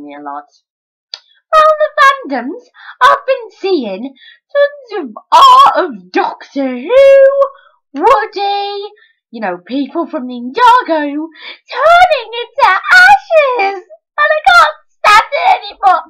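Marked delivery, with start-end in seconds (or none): 1.5-13.8 high, loud, hammed-up wail; mock wailing rant. Fake outrage over fandom art. 14.2-16.0 high, loud hammed-up cry; mock despairing shout. Melodramatic outburst.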